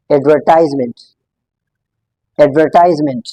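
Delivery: एडवर्टाइजमेंट with a long "tize" syllable; British-style pronunciation here. Shown as a demonstration. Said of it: "इसको हम British English में".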